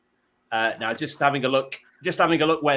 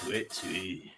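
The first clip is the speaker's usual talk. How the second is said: soft, low mock Yorkshire hoot; Yorkshire owl hoot; 7 semitones lower, 11 dB quieter than usual. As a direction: attempt a Yorkshire owl's twit-twoo. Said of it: "what an owl would sound like with a Yorkshire".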